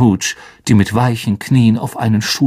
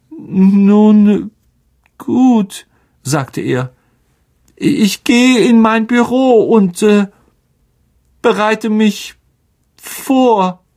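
high, slow, hollow bravado; deflated Lockhart; 10 semitones higher, 4 dB louder, much slower than usual. A narrator's reading. Lockhart agreeing to face the monster, hollow and deflated. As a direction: force the words out, shaky pride.

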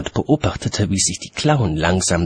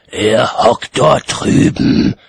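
slow, raised, louder voice; calling out; 4 semitones higher, 7 dB louder, slower than usual. A narrator's reading. Watchman cutting off chattering child, slow loud call. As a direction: cut in loudly, drawing out the words. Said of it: "rief Mumm".

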